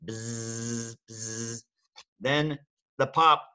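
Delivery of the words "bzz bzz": quiet, low buzzing; buzzing bee. Shown as a demonstration. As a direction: buzz softly like a bee. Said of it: "Then the bee".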